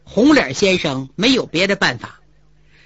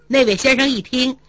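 ordinary delivery, nothing marked

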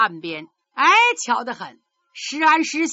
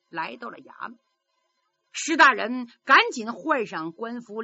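usual speaking voice

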